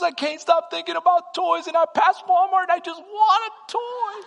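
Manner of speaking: put-on high, loud pleading little boy; preacher voicing his son begging; child's voice. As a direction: whine it like a kid wanting a toy. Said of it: "He goes".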